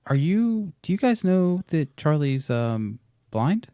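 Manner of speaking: high, careful imitation of a vet; hesitant vet. Voices hesitant vet breaking news.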